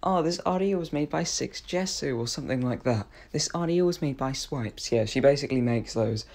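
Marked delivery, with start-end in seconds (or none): 0.0-2.2 imitated audio intro voice. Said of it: "where it says". 3.4-4.9 imitated audio intro voice. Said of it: "at the start of audios on Roblox".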